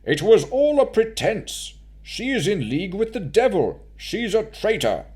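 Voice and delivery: high, loud, tight accusation; tense noble brushing off the crowd; thin-lipped Baron. Acted. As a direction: bite out each charge. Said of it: "his lips went thin as he spoke".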